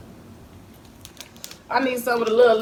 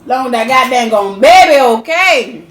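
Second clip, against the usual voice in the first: loud doting call; 4 semitones higher, 15 dB louder, slower than usual. Real, not acted. Mother calling loudly to her baby son, slow.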